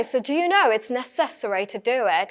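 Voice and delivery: measured, slow British accent; demonstrating a British accent. Shown as a demonstration. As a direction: put on careful British English. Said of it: "अगर आप इसे British accent में".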